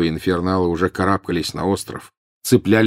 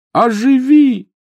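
desperate shout; 7 dB louder, much slower than usual. Acted. Repeating the spell in despair.

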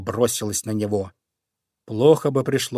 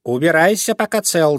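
louder sharp angry order; angry shout; 5 semitones higher, 4 dB louder than usual. Acted. Fox angrily shouts at the young crow, louder. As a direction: chase the crow off. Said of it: "закричала сердито".

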